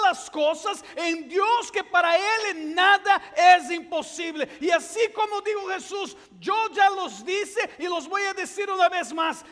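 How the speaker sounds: fervent pulpit shout; preaching at full force. Acted. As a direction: build to a booming sermon climax.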